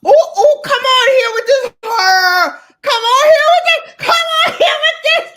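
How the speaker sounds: very high, loud screaming hype; shrieking cheer, half laughing; excited shouting. Real, not acted. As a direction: scream with delight at your bestie. Hyping up friend's lipstick.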